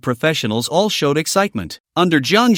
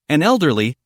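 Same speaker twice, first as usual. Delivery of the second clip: ordinary delivery, nothing marked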